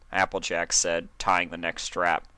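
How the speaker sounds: ordinary delivery, nothing marked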